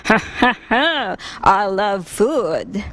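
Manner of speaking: a hearty laugh, then slow words about food; jolly round man. A narrator's reading. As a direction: laugh like a happy, hungry big man. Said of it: "he said".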